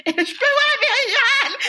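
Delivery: high laughing joy; excited laughing. Retold or imitated. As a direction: squeal it with relief. Joie d'avoir la péridurale. (joie aiguë et rieuse)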